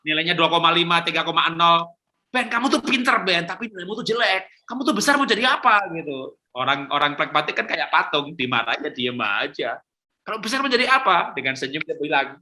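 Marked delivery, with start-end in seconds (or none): 2.3-5.8 raised, exasperated scolding of a lazy son; a father's high-pitched nagging at his son. Retold or imitated. Father scolding his son Ben over his grades, exasperated and high. 10.2-11.3 raised, exasperated scolding of a lazy son; a father's high-pitched nagging at his son. Retold or imitated. Father scolding his son Ben over his grades, exasperated and high.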